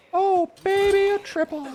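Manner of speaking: high, thrilled squeal of delight; excited squeal. Real, not acted. Streamer cheering a triple kill, squealing high with delight. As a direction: squeal it out like you can't believe your luck.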